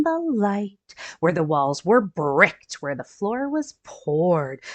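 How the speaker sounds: storybook read-aloud for little ones; gentle picture-book narration; reading to children. A narrator's reading. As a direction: read it like story time for kids.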